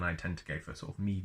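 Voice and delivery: ordinary delivery, nothing marked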